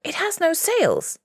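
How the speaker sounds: loud, high-pitched alarmed cry; startled shout from the riverbank. A narrator's reading. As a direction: yell it high, raising the alarm. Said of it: "he cried".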